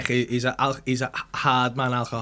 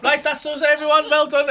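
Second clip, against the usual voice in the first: director's wrap call; 12 semitones higher, 6 dB louder than usual. Retold or imitated. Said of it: "he went".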